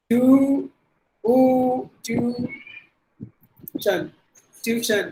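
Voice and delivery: tuition in two syllables, TYOO-shun; common mispronunciation. Shown as a demonstration. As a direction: drop the i from tuition. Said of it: "இப்படி தான் நம்ம சொல்றோம் actually".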